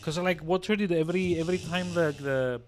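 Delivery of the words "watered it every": ordinary delivery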